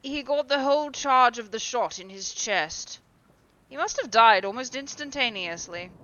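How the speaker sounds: a hint of detached gentleman; lightly voiced Lord Henry. A narrator's reading. Lord Henry explains the death.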